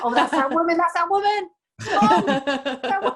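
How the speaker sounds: mock panic. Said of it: "has panic attacks every time she".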